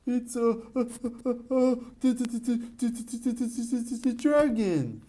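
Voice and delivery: high, stammering in panic over every sound; panicked stammer. Acted. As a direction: stutter it out, too scared to say dragon. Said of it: "said Mister Jelly in a panic".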